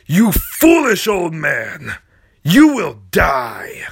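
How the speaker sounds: slow, loud furious threat; villain rage shout. Acted. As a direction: roar while crushing him. Hamburger crushing Mister Tingle.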